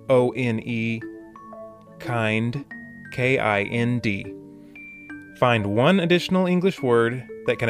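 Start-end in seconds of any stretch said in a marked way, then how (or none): none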